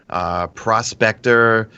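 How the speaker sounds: ordinary talk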